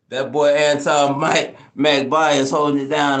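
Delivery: big it up for the homies; hype shout-out. Real, not acted. Streamer thanking a viewer for the gift, loud slow hype shout-out.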